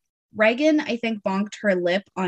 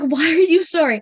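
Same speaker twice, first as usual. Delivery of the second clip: high, loud mock bewilderment; baffled questioning; 7 semitones higher, 5 dB louder than usual. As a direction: ask it as an exasperated, puzzled mom. Mom retells baffled question to toddler.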